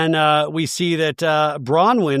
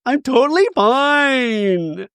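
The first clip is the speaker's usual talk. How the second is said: high, drawn-out wail on the last word; mock wailing denial; 7 semitones higher, 3 dB louder, much slower than usual. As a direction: imitate someone falling apart while insisting. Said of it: "You don't seem fine".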